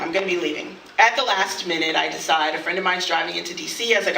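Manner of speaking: normal talking voice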